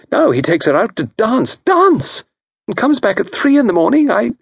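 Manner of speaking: a wronged wife complaining; aggrieved wife. Acted. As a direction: pour out her grievance. Mrs Packington rant.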